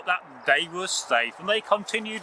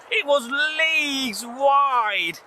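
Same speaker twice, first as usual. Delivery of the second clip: slow dramatic awe; 6 semitones higher, much slower than usual. A narrator's reading. Dramatic narration.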